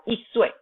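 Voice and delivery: 一岁 with 一 high and falling, not rising; mispronounced tone. Shown as a demonstration. Teacher imitates a learner's second error, wrong tone. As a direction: drop 一 sharply from high in 一岁. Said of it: "I know that sounds wrong".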